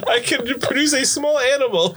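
very high, squeaky laughing voice; helpless laughter. Real, not acted. Game master teases player about stupid spells, crying with laughter. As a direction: talk while cracking up, nearly crying.

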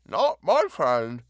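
talking around a mouthful of peanut butter; mouth full. A narrator's reading. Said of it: "said thickly".

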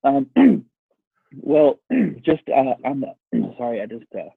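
coughing and clearing throat mid-sentence; choking on a drink. Real, not acted. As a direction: struggle through words after water went down wrong. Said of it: "went down the wrong hole".

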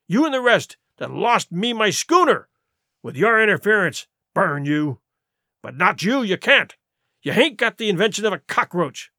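scolding pirate chief, punching words then dropping to a stern finish; angry pirate leader. Acted. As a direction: dress down an insolent crewman. Silver berates George in the story.

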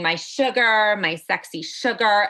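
normal talking voice

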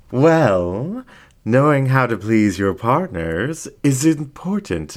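playful cover that slips a little; coy and playful fox. Acted. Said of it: "tried to be coy and playful, but slipped".

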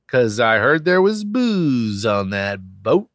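ordinary talk

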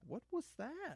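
high, hushed, puzzled; mock disbelief under the breath; baffled. Friends reacting to Gil, high and puzzled. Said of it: "We were like".

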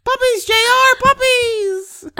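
high squeal, very loud; delighted puppy squeal; squealing puppies. Real, not acted. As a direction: shriek it with delight. Excited squeal about puppies.

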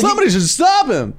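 very high, hammed-up outrage; exaggerated crowd-work punchline; mock stand-up comic. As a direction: exclaim it like a hacky comedian. Continues the mock stand-up act.

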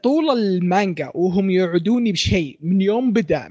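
normal talking voice

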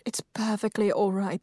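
ordinary delivery

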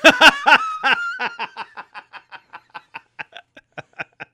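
a long, high burst of real laughter. Real, not acted. Friend hears about the cheating goal, bursts out laughing.

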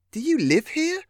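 very high, amazed question; astonished. A narrator's reading. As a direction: ask it in wide-eyed astonishment. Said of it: "asked Lillian in astonishment".

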